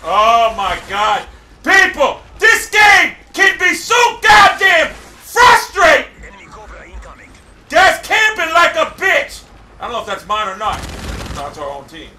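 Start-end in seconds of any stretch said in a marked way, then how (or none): 1.6-9.4 high, slow, emphatic rage; frustrated shouting. Real, not acted. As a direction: vent real frustration word by word. Gamer vents frustration.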